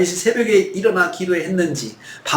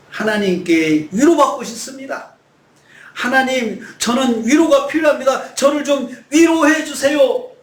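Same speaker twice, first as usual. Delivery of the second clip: pleading shout; 6 semitones higher than usual. Acted. Preacher as David shouting a plea for comfort. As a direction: shout the prayer, slowing on 위로해.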